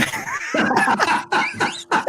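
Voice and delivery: high-pitched burst of laughter. Real, not acted. Teller cracking up at Tony's comeback, laughing.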